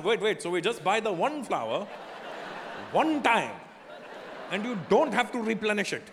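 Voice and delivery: lightly voiced Indian uncle, pleased with himself; a touch of Indian auntie thrift; hint of Indian accent. Mocking Indian relatives' logic.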